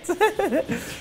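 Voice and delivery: bright high laugh at himself; real chuckle, high. Real, not acted. Watching his own clip, high laugh.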